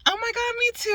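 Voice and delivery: very high, squeaky mock squeal; squealing girlfriend impression. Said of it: "She's like".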